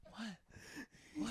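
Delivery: slow urgent whisper; retold whisper. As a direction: whisper as if someone's in the house. Retold whisper hearing noise.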